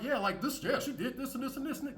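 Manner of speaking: imitating a man boasting about his woman; mock bragging. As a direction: brag like a proud fool. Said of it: "be bragging like".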